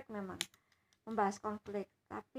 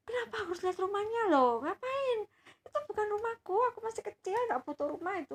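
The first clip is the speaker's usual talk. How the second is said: put-on annoyed child voice; 11 semitones higher, 7 dB louder than usual. Granddaughter insists to grandpa he may buy it. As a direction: whine it like a fed-up kid.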